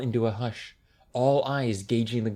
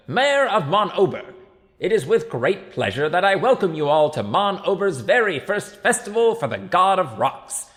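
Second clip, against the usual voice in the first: loud, high public-speech voice; mayor addressing a crowd; 6 semitones higher, 5 dB louder than usual. A narrator's reading. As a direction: project grandly to the back of the square.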